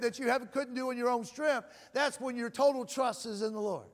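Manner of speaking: normal talking voice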